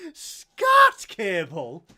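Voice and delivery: loud, slow disbelieving question; very loud drawn-out disbelief; incredulous echo. Real, not acted. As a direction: repeat it in shock. Incredulous repeat of odd word.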